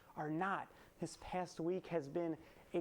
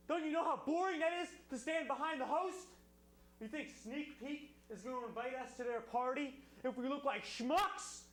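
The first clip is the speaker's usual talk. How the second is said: angry screaming rant; 8 semitones higher than usual. Acted. Host reads Martinson letter angrily.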